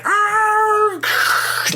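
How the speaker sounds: high strangled yelp of a thief nearly falling; imitated yelp. Thief yelps as his bike spins around, high yelp. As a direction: squeal it out high and sudden.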